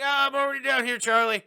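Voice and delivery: loud, high put-on call; Grandpa Joe. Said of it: "and he's like".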